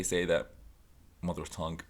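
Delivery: ordinary talk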